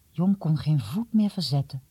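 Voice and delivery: usual speaking voice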